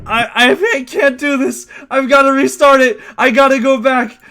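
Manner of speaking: high, loud, exasperated complaining; frustrated loud. Real, not acted. Upset after skipping cutscene.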